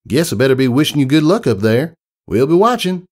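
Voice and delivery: Southern country mare. Acted. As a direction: give it a down-home Southern twang. Applejack voiced in story.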